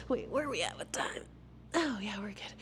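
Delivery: hushed, nearly whispered check; very soft private aside; aside to self. Real, not acted. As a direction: drop to a whisper, checking for yourself.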